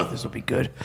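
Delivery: amused aside. Retold or imitated.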